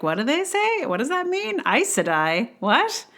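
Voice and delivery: high-pitched bewilderment; mock confusion. Reader seeing made-up words spelled, high mock bewilderment. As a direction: go up high as if baffled.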